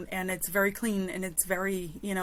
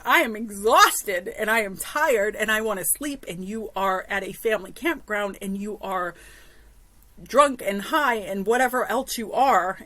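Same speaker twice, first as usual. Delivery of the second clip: exasperated, louder, emphatic telling-off; retold yelling; 5 dB louder than usual. Exhausted camper telling off drunk neighbours, exasperated retold yell. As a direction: re-enact scolding, punching the key words. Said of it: "and so I start yelling".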